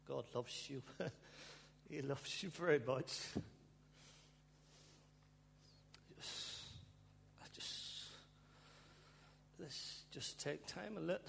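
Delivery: halting, fighting back real tears; overcome, barely getting the words out; choked up. Real, not acted. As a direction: voice breaking into breathy gasps. Preacher overcome, fighting tears mid-sermon.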